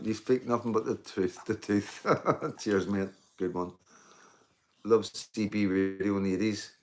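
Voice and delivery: ordinary talk, nothing marked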